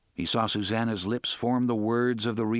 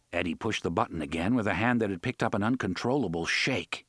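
ordinary delivery, nothing marked

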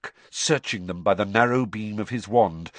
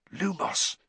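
whispered; 5 semitones higher, 5 dB quieter than usual. A narrator's reading. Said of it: "Harry whispered".

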